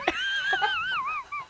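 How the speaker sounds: squealing laugh; high laugh burst. Real, not acted. After the Ziggler quote.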